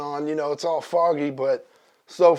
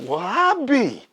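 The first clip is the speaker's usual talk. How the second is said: put-on high, drawn-out old-man astonishment; mock amazement; 7 semitones higher, slower than usual. Old neighbor seeing the ducks, drawn-out mock amazement. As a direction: stretch it out, pitched up in wonder.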